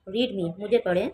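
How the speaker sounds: normal talking voice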